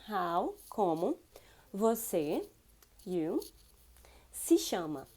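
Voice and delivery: each word of como você se chama said apart slowly; careful teacher breakdown. Shown as a demonstration. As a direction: break the question into pieces. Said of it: "you're gonna use the words".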